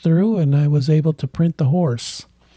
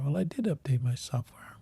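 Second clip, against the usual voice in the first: under-the-breath whisper, mildly annoyed; whispered retort; 10 dB quieter than usual. Real, not acted. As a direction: mutter it back at the screen in a whisper. Muttered retort at software prompt.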